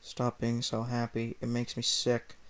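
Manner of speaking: low, soft, slow sour drone; miserable clerk. Acted. Clerk scolds happy Mario, low sour drone. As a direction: grumble it like a sick-of-life clerk. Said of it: "said the employee".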